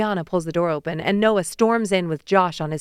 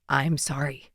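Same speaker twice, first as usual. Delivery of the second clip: soft, low, hushed apology; a subdued, choked-back sorry; quiet apology; 4 semitones lower, 5 dB quieter, slower than usual. Acted. Noah softly apologizing to Macy, hushed and low.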